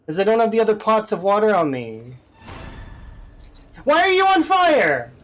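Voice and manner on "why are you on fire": much higher, louder, almost wailing; exasperated disbelief. Real, not acted. Gamer wails at the object that caught fire in disbelief. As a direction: cry out at the absurdity. Another thing catches fire.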